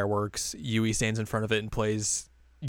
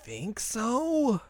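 coy; 12 semitones higher, much slower than usual. Real, not acted. Playful unsure reply.

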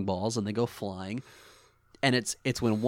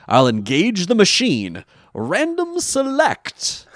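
announcer voice; 8 semitones higher, 5 dB louder, slower than usual. Retold or imitated. Quoting the machine operator.

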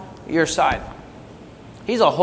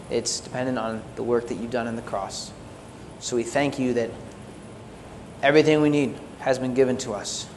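lower, softer praying voice; closing prayer; 5 semitones lower, 5 dB quieter than usual. Real, not acted. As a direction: talk to God quietly and earnestly, leading the congregation.

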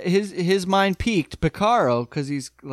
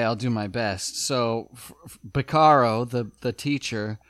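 ordinary delivery

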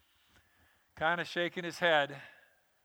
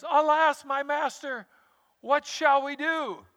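high, loud alarmed cry of a servant; panicked plea to his master; frightened servant; 8 semitones higher, 4 dB louder than usual. Acted. Servant panics to his master at the surrounding army: high alarmed cry.

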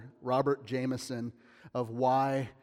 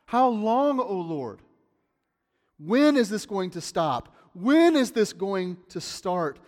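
louder, higher pleading "God, how long?"; lament cry; 4 semitones higher, 6 dB louder, slower than usual. Retold or imitated. Praying believer crying out to God, loud and pleading. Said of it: "like the Psalms, you've said".

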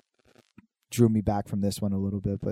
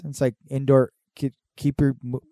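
ordinary delivery

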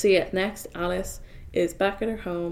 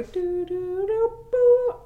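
rising doo sound effects; 12 semitones higher, 6 dB louder, much slower than usual. Pretend. Mimicking sperm diving.

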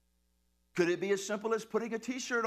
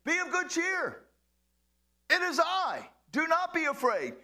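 higher, calm reassuring call; Jesus calming his disciples, raised reassuring voice; 9 semitones higher, 3 dB louder than usual. Acted. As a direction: reassure the frightened men from afar. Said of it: "Jesus spoke to them, saying".

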